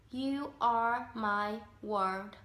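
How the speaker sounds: very slow, word-by-word model ending on "world"; careful world pronunciation. Shown as a demonstration. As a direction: space out each word, finish "world" with R and D. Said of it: "mà phải đọc là world".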